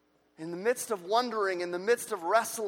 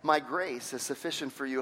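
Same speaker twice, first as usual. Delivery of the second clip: low, soft and calm reply; God answering Paul's plea, soft and steady; gentle voice of God; 10 semitones lower, 5 dB quieter than usual. Retold or imitated. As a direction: answer tenderly, like a reassuring father. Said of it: "And he's saying".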